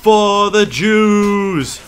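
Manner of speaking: very slow drawn-out war shout; battle cry. Acted. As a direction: bellow it as you charge.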